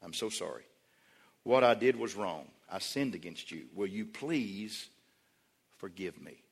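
heartfelt apology. Acted. Spouse confessing a sin to the other, softly contrite. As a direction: humbly ask forgiveness, hushed and slowing. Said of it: "confessed it and said".

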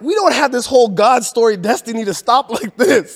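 high, loud, laughing exclamation; hammed-up disbelief at the setback; exasperated quote. Voicing the family's outrage at the deportation, high and loud.

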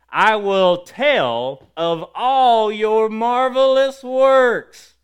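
high, loud, slow shout of praise; emphatic proclamation. Real, not acted. Preacher declaring God's works to the church, emphatic proclamation. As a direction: belt it out with long held words. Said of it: "state something emphatically".

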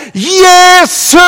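high, long ringing call; shouted name. Retold or imitated. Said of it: "så ropte han enda høyere".

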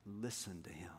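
very soft, low and solemn; hushed command. Acted. God's direct message to us, quiet and solemn. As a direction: drop low and say it gently.

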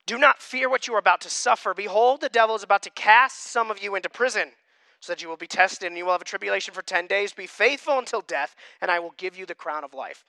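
loud, deliberate Bible reading to the congregation; reading Revelation aloud to the church, in a proclaiming voice; scripture reading. Acted. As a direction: proclaim the passage from the pulpit. Rereads verse ten aloud.

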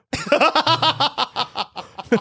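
breaking into high laughter. Real, not acted. Laughing mid-sentence.

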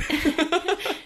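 high burst of laughter; squealing laugh. Real, not acted. Laughing at racist nan joke.

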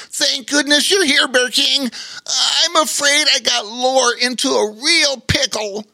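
high-pitched otter voice, admitting trouble; sad river otter. A narrator's reading. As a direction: confess in a high, plaintive character voice. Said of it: "admitted the sad river otter".

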